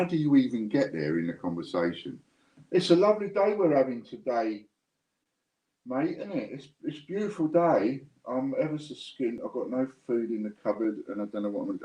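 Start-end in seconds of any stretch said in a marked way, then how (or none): 2.7-11.8 high, sing-song fake small talk hinting at hunger; hinting beggar. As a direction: pitch it high and chirpy, fishing for a handout. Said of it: "without sounding like you're begging".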